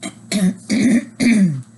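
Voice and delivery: loud, repeated throat clear; real rough throat clearing. Real, not acted. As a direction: clear the throat hard before reading on. Reader clears throat after title.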